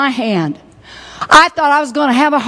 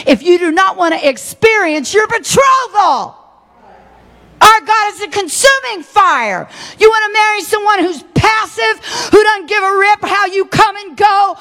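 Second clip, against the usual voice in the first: higher, shouting testimony; preaching at full force; 4 semitones higher than usual. Acted. Preacher to congregation, shouting testimony of her baptism. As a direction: preach it out, on fire.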